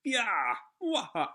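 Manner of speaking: wild shout. Acted. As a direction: whoop like a rampaging brute. Voicing rampaging Oni shouts.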